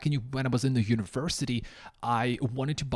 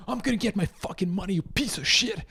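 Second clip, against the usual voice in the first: fast, raised angry demand; demo of yelling; 4 semitones higher than usual. Shown as a demonstration. Customer yells at Ukrainian store for refund, fast angry demand. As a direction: bark it at them to be taken seriously. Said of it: "you have to yell, like, high volume, high pitch".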